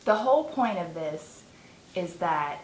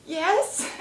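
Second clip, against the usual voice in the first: breathy eager yes; 9 semitones higher than usual. Retold or imitated. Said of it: "And I'm like".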